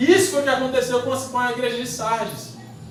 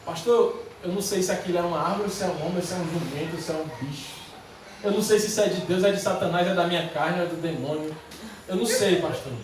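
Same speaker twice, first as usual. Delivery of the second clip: low, quick list trailing into softness; confused believer; 5 semitones lower, 4 dB quieter than usual. Acted. Unsure church member confessing confusion to the pastor, low and trailing. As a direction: confide doubts quietly, dropping away. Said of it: "Quem que já se sentiu assim?".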